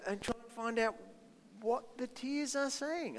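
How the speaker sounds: usual speaking voice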